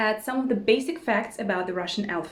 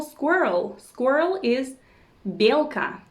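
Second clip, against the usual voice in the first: usual speaking voice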